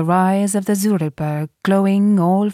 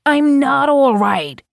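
loud, slow, higher angry protest; angry outburst; 9 semitones higher, 5 dB louder, slower than usual. A narrator's reading. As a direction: hammer each word in anger. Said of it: "he cried out angrily".

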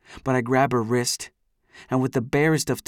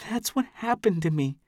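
put-on upset young woman; 6 semitones higher than usual. A narrator's reading. Amy's voice in the narration.

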